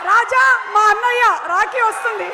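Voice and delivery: loud fast playful shout. Real, not acted.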